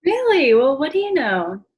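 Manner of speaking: Really jumps high and loud in fake amazement; mock surprise. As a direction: pretend to be astonished, tongue in cheek. [name] feigns delight at engagement.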